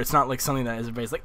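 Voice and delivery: normal talking voice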